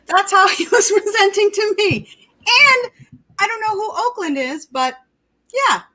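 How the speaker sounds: high, giggling through the words; real embarrassed giggle; laughing. Real, not acted. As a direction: laugh while admitting it. Embarrassed about butt bumping story.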